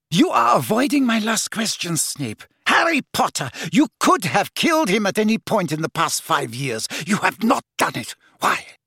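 high, insistent interrogation; heated villainess cross-examining; Bellatrix, accusing. Acted.

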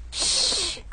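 hissing snorkel breath; Darth Vader breathing. Acted. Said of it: "breathing sound just like Darth Vader's".

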